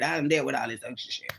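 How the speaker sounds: fast unvoiced whisper; whispered aside. Real, not acted. Telling viewers what she's done with, whispered fast. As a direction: hush it like a secret. Aside about past troubles.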